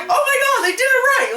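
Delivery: high shouted joy; excited fan cheer. Retold or imitated. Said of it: "We were just like".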